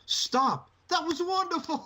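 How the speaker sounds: hammed-up Matt Hardy cry; imitating Matt Hardy. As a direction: yell it high like Matt Hardy. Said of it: "Matt just goes".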